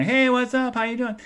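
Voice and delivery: high-pitched chirpy hello; friendly greeting. Retold or imitated. Traveler spotting an old colleague, high friendly greeting. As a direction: greet them high, re-enacting it.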